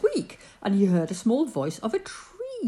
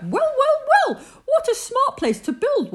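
much higher, bright chirps; chirping bird; 5 dB louder than usual. Acted. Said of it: "he chirped".

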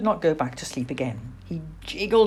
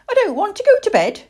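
high, loud, quick little-owl protest; young owl Plop, protesting; 7 dB louder, faster than usual. Acted. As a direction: owlet refusing bedtime. Owlet refusing bedtime.

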